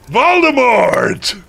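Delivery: high, drawn-out villain announcement; dramatic reveal. A narrator's reading. Narrator revealing who the horrible man was, hammed-up dramatic cry. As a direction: cry the name out slowly, hammed-up.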